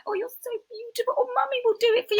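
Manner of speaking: put-on very high, gushing baby-talk; hammed-up cooing over a little boy; doting mummy. Mother fussing over her son, gushing high mummy voice.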